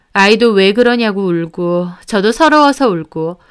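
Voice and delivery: plain reading voice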